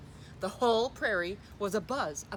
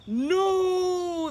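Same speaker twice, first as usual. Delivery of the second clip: loud shouted no; crowd yell; 9 semitones higher, 9 dB louder than usual. A narrator's reading. Crowd answers Pipsqueak about fuzz, loud shouted no. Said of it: "the crowd yelled".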